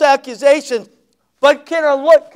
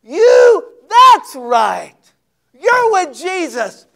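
accusing servant; 8 semitones higher, 5 dB louder, much slower than usual. Retold or imitated.

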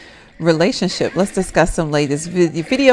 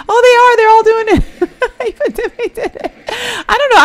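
high laughing squeal, much louder; laughing surprise; 5 dB louder than usual. Real, not acted. Streamer squealing with laughter as sims all skinny dip. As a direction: squeal through giggles at the screen.

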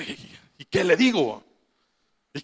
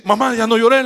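high, louder pleading with mother; retold urgent plea; 5 semitones higher, 5 dB louder than usual. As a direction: beg her to stop, lightly acted. Retells pleading with crying mother.

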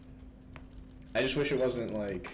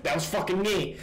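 higher and louder, indignant; indignant outburst; 7 semitones higher, 4 dB louder than usual. Retold or imitated. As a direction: blurt it out in outrage. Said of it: "Nolan's like".

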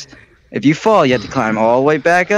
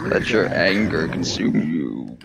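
sinister Sith voice; 9 semitones lower, 7 dB quieter than usual. Pretend. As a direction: tempt them darkly, lower. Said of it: "You look very angry".

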